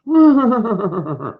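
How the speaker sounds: high breathy donkey bray; mock bray. Shown as a demonstration. Teacher showing the kids a zebra's bray, high bray. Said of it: "Braying like a zebra".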